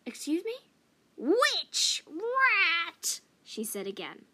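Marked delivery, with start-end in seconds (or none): 0.1-0.6 put-on taken-aback young cat. A narrator's reading. 1.2-3.2 much louder and higher, each word punched; insistent old cat. A narrator's reading. Old cat repeating it for the youngster, loud and insistent.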